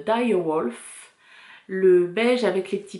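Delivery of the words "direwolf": name said slowly with an English accent. Shown as a demonstration. Knitter showing viewers a colourway name, English accent. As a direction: put on a careful English accent. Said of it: "je travaille mon accent anglais".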